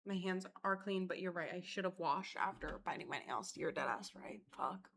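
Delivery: soft, sheepish, retold apology; mock apology. Server apologising to the angry couple, softly sheepish. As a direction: mumble it like you've been caught out. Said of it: "And I was like".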